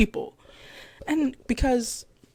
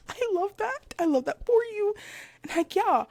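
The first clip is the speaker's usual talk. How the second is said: mildly gushing, pitched up high; enthusiastic gushing; 11 semitones higher than usual. Retold or imitated. Telling a customer she loves that for her, enthusiastic gushing. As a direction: cheer them on in a higher voice.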